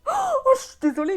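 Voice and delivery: high, loud gasp then apology; disgusted gasp. Real, not acted. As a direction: recoil from the stench out loud. Recoiling from the smell. (cri de dégoût)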